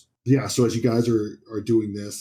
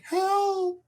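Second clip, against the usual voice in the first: high, distant cry of Help; woman's cry for help. Acted. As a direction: call out faintly like a woman in trouble. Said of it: "It's like a woman's voice".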